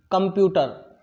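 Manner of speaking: कंप्यूटर said carefully, the way students should copy it; model pronunciation. Shown as a demonstration. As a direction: voice कंप्यूटर clearly as a model word. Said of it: "मैं बोलूँगा, फिर आपको बोलने के लिए कहूँगा". (कंप्यूटर का स्पष्ट उच्चारण)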